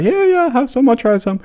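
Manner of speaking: very high, loud mock cop eagerness; squeaky eager yes; keen policemen. Policemen eagerly accepting tea, high and loud. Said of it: "a lot of the policemen are like".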